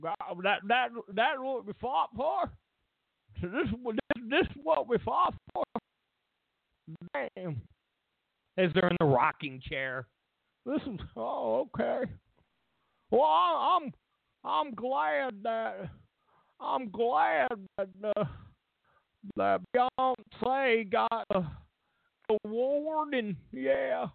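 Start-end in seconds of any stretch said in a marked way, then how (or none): 0.0-5.6 lightly voiced disbelieving elder; mild mock shout from the rocking chair, pitched up; a touch of a bewildered grandpa; a hint of an old man. 10.7-24.1 high, very slow, forced politeness; high, halting, forced gladness; reluctant elder. Pretend. Elder forcing polite acceptance.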